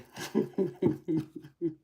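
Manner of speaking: high giggle, tailing off; real, amused giggling at your own joke; giggling laugh. Real, not acted. Repeats joke about her age.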